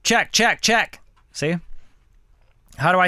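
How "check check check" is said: high, loud mic check. Shown as a demonstration. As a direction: call it out for the level check. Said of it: "So here's my gain".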